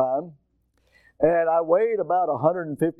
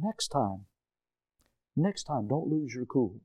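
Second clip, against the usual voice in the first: soft, low, measured advice; quiet voice; 5 semitones lower, 8 dB quieter than usual. Retold or imitated. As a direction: advise him quietly, with pauses. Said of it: "that quiet voice come up".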